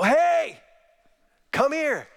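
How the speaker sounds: put-on high, loud playful call to kids; excited dad. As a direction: burst out, calling them over for a tussle. Acts out greeting his kids excitedly.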